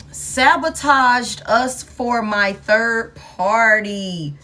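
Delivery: every word drawn out; very slow, deliberate announcement; slow, dramatic read-out. Real, not acted. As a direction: stretch it out for dramatic weight. Reads out card with dramatic slowness.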